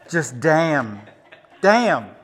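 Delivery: loud, drawn-out damn; disgusted damn. Acted. Photographer reacts to cheesy wedding poses, loud and appalled. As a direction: groan it out, appalled.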